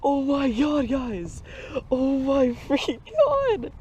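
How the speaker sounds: high squeal breaking with excitement; voice cracking. Real, not acted. Angler seeing his biggest bass ever, voice cracking. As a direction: let the voice crack, drawn out and overjoyed. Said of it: "Voice crack".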